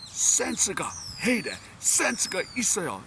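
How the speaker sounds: high, very fast Korean; Korean coworker impression. Coworkers repeating the sense phrase, high and fast. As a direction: fire it off like a busy coworker. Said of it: "I just hear that so many times".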